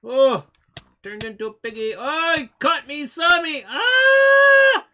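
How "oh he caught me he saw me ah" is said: loud high scream of alarm; panicked scream. Real, not acted. Gamer caught by the hunter, screaming.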